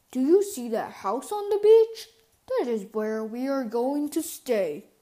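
proud dad pointing out the beach house; Papa Bear. A narrator's reading. Papa Bear shows family the beach house, lightly voiced papa.

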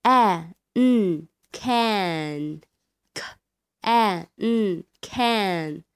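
very slow, drawn-out can; slow syllable drill. Shown as a demonstration. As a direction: stretch each can slowly, like a phonics teacher. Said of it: "先读后面的an". (慢速拼读)

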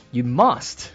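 usual speaking voice

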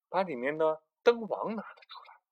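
ordinary delivery, nothing marked

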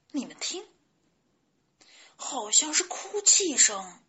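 astonished little chick; surprised. A narrator's reading. Chick hearing sobs nearby, surprised. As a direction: alert the others in startled wonder. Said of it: "卡梅利多惊讶地说".

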